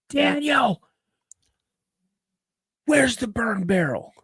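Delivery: mock holler, high-pitched and drawn out; retold dad's yell. Dad yelling for his son about the burn barrel, mock holler. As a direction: imitate an angry dad calling out. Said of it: "I hear him yell".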